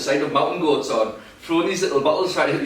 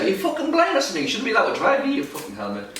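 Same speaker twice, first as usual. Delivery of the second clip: fast, high mock old-man rant; hammed-up old bloke ranting; angry old man; 6 semitones higher than usual. Old driver ranting at him, fast high mock rant. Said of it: "He's like".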